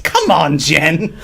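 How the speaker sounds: Irish accent. Shown as a demonstration. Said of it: "that is so good".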